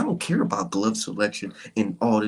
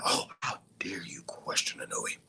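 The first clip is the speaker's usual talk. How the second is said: mock pearl-clutching; 6 semitones lower than usual. Mocking fans' reverent outrage.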